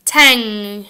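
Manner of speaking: "Tang." with a long back nasal NG. Shown as a demonstration. Teacher drilling tang after tan, nasal NG. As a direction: ring "Tang." out through the nose. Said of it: "Your tongue goes up in the back".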